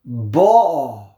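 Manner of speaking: "Ba'a" with a loud, long first syllable; stress on first syllable. Shown as a demonstration. Teacher showing where the accent falls, front-stressed "Ba'a". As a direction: punch the BA in "Ba'a", let the end fall away. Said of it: "under the second to last syllable".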